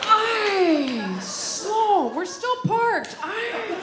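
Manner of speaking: a fretting mother clutching the rail; imitating panicky mom. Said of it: "she's just".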